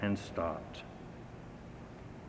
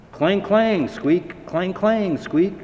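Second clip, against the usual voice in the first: high loud clanging bell; 9 semitones higher, 6 dB louder, slower than usual. Acted. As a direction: ring it out like an old bell. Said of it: "the old bell rang".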